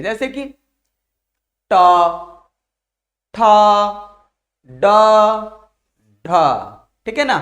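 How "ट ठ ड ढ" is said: "ट, ठ, ड, ढ," each alone, tongue curled to the front palate; demonstrated retroflex sounds. Shown as a demonstration. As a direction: sound out "ट, ठ, ड, ढ," one at a time. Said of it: "आगे वाला जो छत है हमारा मुड़ जा रहा है". ("ट, ठ, ड, ढ," मूर्धा से, अलग-अलग बोलकर दिखाते हुए)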